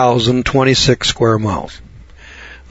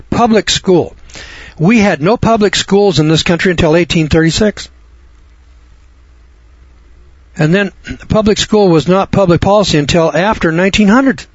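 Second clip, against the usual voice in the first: fiery preaching; 6 semitones higher than usual. Acted. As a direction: drive the point home like a preacher. Heated preaching, pitch climbing.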